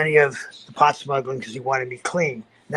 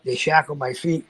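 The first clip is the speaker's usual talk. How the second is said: ordinary talk, nothing marked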